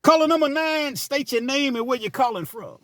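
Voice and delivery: high-pitched radio DJ taking a call. Acted. DJ answering caller number nine, radio DJ voice. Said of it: "hit y'all with the D J voice".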